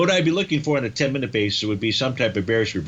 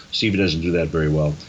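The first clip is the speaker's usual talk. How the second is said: ordinary talk, nothing marked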